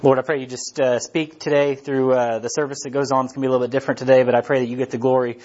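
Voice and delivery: low, earnest prayer voice; closing prayer. Real, not acted.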